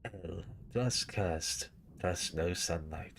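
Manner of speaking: slow, solemn knight lament; Solaire voice. Shown as a demonstration. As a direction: drag it out like a sorrowful knight. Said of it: "I do a really good Solaire".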